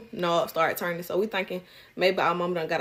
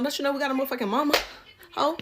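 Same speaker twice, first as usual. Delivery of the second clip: normal talking voice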